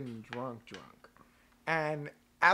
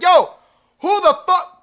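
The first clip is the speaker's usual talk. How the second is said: loud, high challenging yell; retold shout; 11 semitones higher, 14 dB louder than usual. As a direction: shout the challenge at the guys outside. Gil challenging fools outside.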